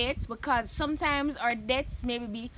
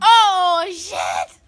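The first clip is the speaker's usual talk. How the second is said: shocked outburst; 4 semitones higher, 11 dB louder than usual. Real, not acted. Vlogger reacts in shock to a raunchy question. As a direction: blurt it in shock.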